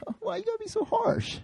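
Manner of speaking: high, whiny hurt voice; mock-wounded disciple whining at Jesus; whiny mock reply. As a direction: whine like a sulky kid who got told off. Whiny reply to being rebuked.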